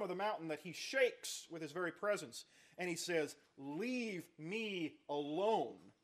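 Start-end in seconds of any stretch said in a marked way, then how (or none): 3.6-5.9 very slow, stretched divine command; God's warning. Acted. Preacher voicing God warning Moses, very slow. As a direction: stretch it out, heavy and final.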